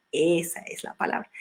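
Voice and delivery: ordinary delivery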